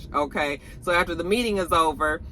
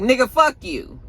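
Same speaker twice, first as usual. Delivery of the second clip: sassy retort; 6 semitones higher, 3 dB louder than usual. Retold or imitated. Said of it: "she was like".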